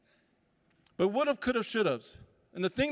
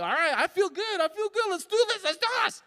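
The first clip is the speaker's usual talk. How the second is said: put-on much higher, eager little-kid voice; pumped-up baby; 10 semitones higher than usual. Baby gearing up to walk, high and eager. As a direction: psych yourself up like a toddler. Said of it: "He's like".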